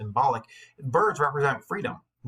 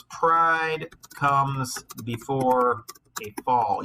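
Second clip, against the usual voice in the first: very slow, weighty proverb; solemn saying; 3 dB louder, much slower than usual. Shown as a demonstration. Teacher quoting the proverb to the class, slow and solemn. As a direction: lay each word down gravely. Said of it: "There's this saying that, um, goes".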